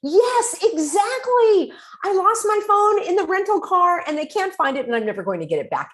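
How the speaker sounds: high, bright-voiced relief at being understood; the friend finally feels heard and lights up; relieved yes. Friend relieved to be heard, high and emphatic. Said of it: "that's when the person says".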